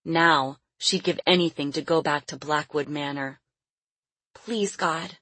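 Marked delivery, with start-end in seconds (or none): 4.3-5.2 put-on soft little girl's prayer; praying child. A narrator's reading. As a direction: pray softly, pleading like a child. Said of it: "she prayed".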